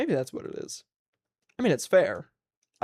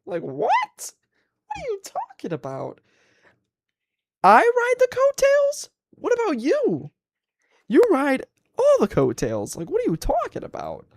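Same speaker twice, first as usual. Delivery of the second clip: incredulous retort; 12 semitones higher, slower than usual. Retold or imitated. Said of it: "And I was like".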